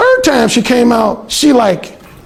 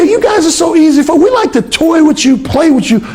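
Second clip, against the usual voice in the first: higher, playful mock woman's voice; teasing roommate; 8 semitones higher than usual. Alan imitating the roommate boasting about toying with men. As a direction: tease it out in a raised girly tone.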